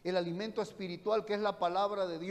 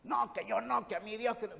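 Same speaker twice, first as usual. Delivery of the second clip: mocking refusal; 4 semitones higher than usual. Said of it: "Allá me puede hablar muy fuerte".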